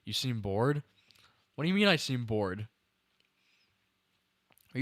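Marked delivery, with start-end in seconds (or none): none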